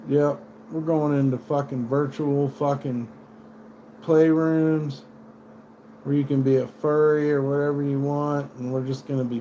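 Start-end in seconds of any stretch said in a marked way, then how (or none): none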